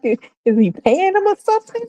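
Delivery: baffled suspicious question; stunned viewer wondering aloud; incredulous. Retold or imitated.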